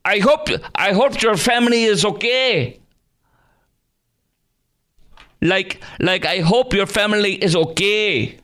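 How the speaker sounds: very high, exaggerated parody of a politician; mocking Harris. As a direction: ham up her lines in a squeaky high voice. Host parodies Harris clip.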